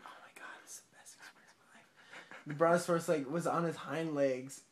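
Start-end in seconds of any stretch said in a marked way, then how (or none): 0.0-2.5 hushed, whispered amazement; retold awe under the breath; awed whisper. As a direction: whisper it in quiet wonder. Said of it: "he's like".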